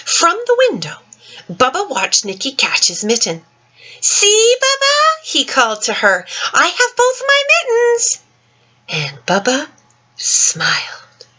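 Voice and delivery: put-on gentle, lilting read-aloud for children; story reading. Teacher reading The Mitten to children, lilting storytime voice. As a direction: read it like storytime to little ones. Reads picture book aloud.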